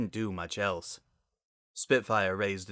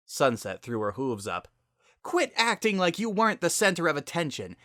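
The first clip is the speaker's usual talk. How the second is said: higher, louder bitter tirade; resentful rant; 9 semitones higher, 5 dB louder than usual. Acted. Sunset venting at her sister, a higher, louder resentful rant. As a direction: unload years of jealousy.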